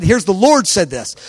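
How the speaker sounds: ordinary delivery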